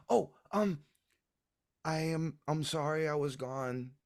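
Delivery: put-on high, halting child's voice; apologetic little boy. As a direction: stammer out an apology to mother. Child sheepishly apologises to his mother.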